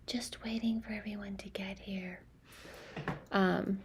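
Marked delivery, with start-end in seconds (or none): 0.1-2.2 whispered send-up of a streamer; mock livestreamer. Mocking people who go live while waiting for viewers, whispered. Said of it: "make fun of people going live".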